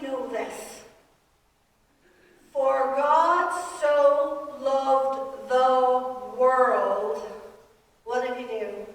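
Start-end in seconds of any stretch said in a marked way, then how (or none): 2.5-7.2 very slow, louder, each word weighed; scripture proclaimed. Real, not acted. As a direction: proclaim the verse like from the pulpit. Quoting scripture in sermon.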